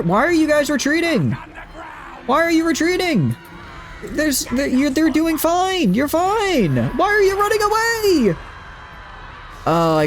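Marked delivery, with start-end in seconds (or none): 0.0-8.4 frustrated yelling. Real, not acted. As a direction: yell at the fleeing troops. Units retreating, yelling at them.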